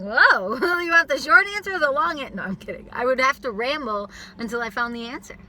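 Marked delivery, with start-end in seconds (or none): 0.0-2.3 high, loud laughing mock reply; giggling playful retort. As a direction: laugh through a cheeky answer. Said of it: "I'd be like".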